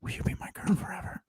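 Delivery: tiny, pleading whisper; whispered plea. Acted. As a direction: whisper a lovestruck proposal under your breath. Secret longing after mock scorn.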